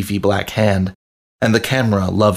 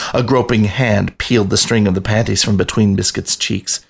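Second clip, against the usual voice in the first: ordinary delivery, nothing marked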